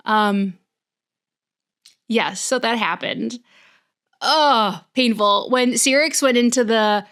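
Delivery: ordinary talk, nothing marked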